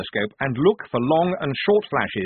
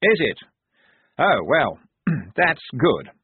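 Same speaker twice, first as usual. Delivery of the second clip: nervous; 5 semitones higher, 5 dB louder than usual. A narrator's reading. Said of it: "said Blouse nervously".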